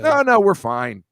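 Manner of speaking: high, loud, slow sarcastic no no; mock reassurance. Joking to the group that they're fine, mock cheerful. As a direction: ham up a fake all-is-well.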